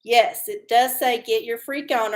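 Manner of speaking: ordinary talk, nothing marked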